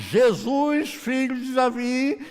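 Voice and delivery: high, stretched-out loud call. Acted. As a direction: cry out stubbornly, dragging the words. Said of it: "ele grita mais". (grito agudo, alto e prolongado)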